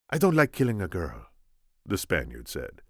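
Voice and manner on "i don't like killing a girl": put-on lightly Spanish-accented Inigo; gentle Spanish accent. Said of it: "my attempt at Inigo".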